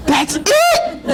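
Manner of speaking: put-on high, excited little girl's cry; joyful exclaim. Father retelling his daughter's delighted cry. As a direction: echo the daughter's delighted shout.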